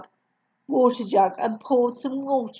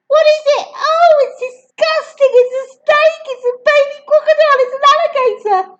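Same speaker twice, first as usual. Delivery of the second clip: put-on much higher, louder panicked children; children shouting; 5 dB louder than usual. Children squealing at the newt in the glass. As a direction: squeal it like frightened kids.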